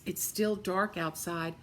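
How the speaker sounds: plain reading voice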